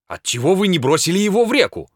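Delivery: taken aback and reproachful; indignant. A narrator's reading. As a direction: ask it in rising indignation. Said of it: "возмущался бледный следователь".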